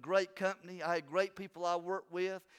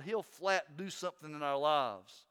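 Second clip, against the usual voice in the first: normal talking voice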